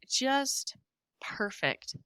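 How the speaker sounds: hushed, as if not to wake her; awed whisper. Real, not acted. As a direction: breathe the word out in a soft whisper. Seeing newborn baby, awed.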